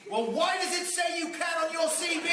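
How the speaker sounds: high, hammed-up outraged yell; mock director shout. Fun dad yelling at his daughter over her acting, in a mock outraged shout. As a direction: shriek like a tyrant director.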